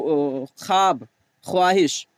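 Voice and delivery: ख्वाब said as खाब, ख्वाहिश as खाहिश, slowly; silent waw. Shown as a demonstration. Teacher showing the written but silent waw. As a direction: drop the व sound entirely. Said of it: "लिखा तो जाता है ख्वाब".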